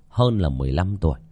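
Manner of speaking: ordinary delivery, nothing marked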